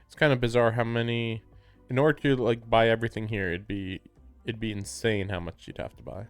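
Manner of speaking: usual speaking voice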